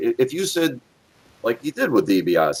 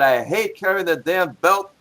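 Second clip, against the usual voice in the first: lightly voiced grumbling wrestler, raised pitch; higher, mock griping voice; Nikolai; 9 semitones higher than usual. Quoting the wrestler on his belt, lightly acted grumble. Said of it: "in fact, he said".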